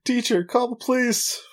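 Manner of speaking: high urgent call out; mock yell for help; shouted call. Imitating Conan's shout.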